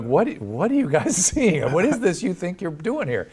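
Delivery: bewildered, swooping questions without real alarm; retold confusion. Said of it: "I was confused. I wasn't horrified".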